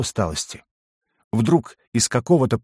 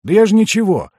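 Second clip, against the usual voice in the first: high, louder protest of innocence; innocent protest; 11 semitones higher, 6 dB louder than usual. A narrator's reading. Kli-Kli to Ugor after being told off, protesting innocence. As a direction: squeal your innocence, pitch jumping up.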